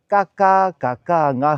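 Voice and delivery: 嘎 said with mouth held open; 张嘴发音; open mouth sounds. Shown as a demonstration. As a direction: keep the mouth open on 嘎嘎嘎嘎啊. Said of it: "张开嘴巴在里面讲的".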